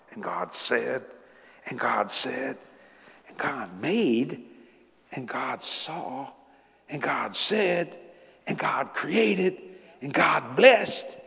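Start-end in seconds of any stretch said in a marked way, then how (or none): 3.3-11.2 slow, deliberate repetition; slowing emphasis. Shown as a demonstration. Preacher stressing how often God speaks, slowing each phrase. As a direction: lay out each phrase slowly. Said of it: "And then I noticed something".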